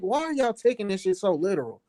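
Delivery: normal talking voice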